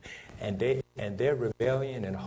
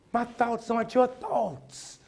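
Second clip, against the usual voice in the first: full-force preaching; 8 semitones higher, 8 dB louder than usual. Acted. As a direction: thunder the scripture out over the congregation.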